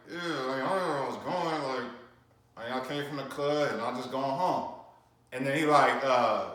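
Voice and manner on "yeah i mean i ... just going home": softer, mumbled words as if through a broken jaw; broken jaw mumble. Shown as a demonstration. Showing viewers how he answered the detective with a broken jaw, mumbled and soft. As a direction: barely move your jaw, mumble it out. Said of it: "I'm talking like this 'cause".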